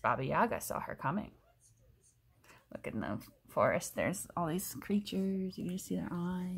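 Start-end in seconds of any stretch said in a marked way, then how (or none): 2.7-6.6 hushed. Real, not acted. Parent points out forest creatures to child, hushed.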